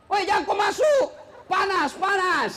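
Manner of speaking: shouted warning. Retold or imitated. Warning man entering lift.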